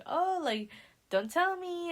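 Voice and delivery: high-pitched imitation of playful chat; mock banter. Imitating Lily and Sam's little banter, high and playful. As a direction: lift it high, playfully put on.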